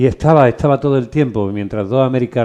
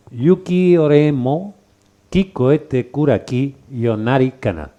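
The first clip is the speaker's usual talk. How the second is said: slow, lilting Japanese verse, higher; slow haiku recitation; 3 semitones higher, slower than usual. Shown as a demonstration. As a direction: recite it slowly like a poem. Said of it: "y decía algo así".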